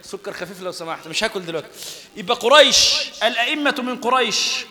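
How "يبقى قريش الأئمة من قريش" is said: loud, ringing stress on قريش; loud emphatic preaching. Real, not acted. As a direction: preach it out at full force. Teacher drives home the hadith.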